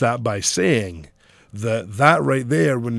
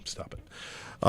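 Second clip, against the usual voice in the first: whispered, much softer; whispered aside; 18 dB quieter than usual. Real, not acted. Man telling someone to stop, whispered aside. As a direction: hush it under your breath. Muttered aside after being corrected.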